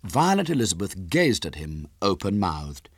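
usual speaking voice

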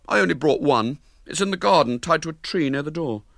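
a hint of a proud boy; boasting boy. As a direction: show off to the girl. William explains.

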